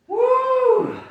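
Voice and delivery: high celebratory whoop; excited whoop. Real, not acted. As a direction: let out a joyful yell. Celebrating finishing the set.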